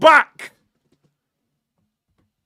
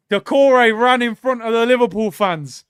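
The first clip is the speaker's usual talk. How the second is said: normal talking voice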